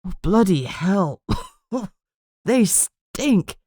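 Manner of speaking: slow, puffed-out disgust; sharp breath out. A narrator's reading. Partner gasping once the smelly ghoul is gone, disgusted. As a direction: blow out held breath, reeling. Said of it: "Channing let out a sharp breath".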